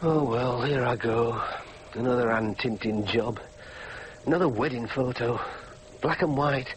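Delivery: low, downbeat talking to oneself; weary musing. Acted. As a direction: think aloud glumly over a dull job. Son alone at dull job, musing.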